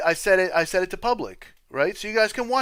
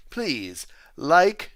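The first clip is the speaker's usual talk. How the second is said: ordinary talk, nothing marked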